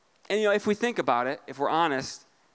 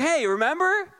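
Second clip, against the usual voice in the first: much higher, pleading whine; mock Adam whine; 6 semitones higher than usual. Pastor voicing Adam reminding God, high pleading whine. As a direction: needle God like a sulky kid. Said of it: "He's like".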